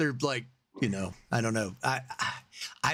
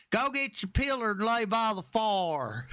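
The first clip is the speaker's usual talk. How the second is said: put-on high, slow Southern drawl of an old grandmother; Southern grandma accent; 8 semitones higher than usual. Imitating great-grandmother from Alabama, Southern accent. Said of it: "So she would say".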